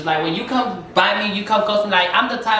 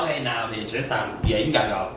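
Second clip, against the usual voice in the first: tired dismissal; 6 semitones lower than usual. Retold or imitated. Tired host sending guests home.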